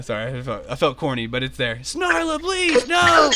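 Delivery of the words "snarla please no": high, hammed-up pleading shout; crying out. Companion begs Snarla to stop, hammy anguished cry. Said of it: "he cries out".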